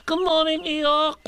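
very high little called-out greeting; Piglet shouting. A narrator's reading. Piglet calling out to Eeyore at the stream, high shout. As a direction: call out brightly in a tiny high voice. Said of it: "shouted Piglet".

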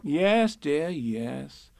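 put-on calm the child down gently; a lightly voiced soothing mother; soothing Kanga. A narrator's reading. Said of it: "said Kanga soothingly".

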